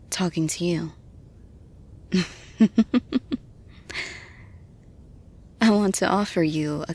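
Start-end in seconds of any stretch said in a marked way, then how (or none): 2.6-3.4 quick, higher-pitched little giggle; light giggle. Pretend. Mentor explaining why they are here, light giggle. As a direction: giggle in a short ripple. Giggle while talking to listener.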